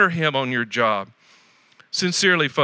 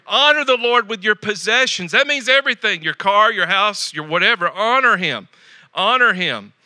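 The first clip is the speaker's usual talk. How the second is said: raised, ringing pulpit voice; preaching at full force; 4 semitones higher than usual. Acted. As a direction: drive each line home to the congregation.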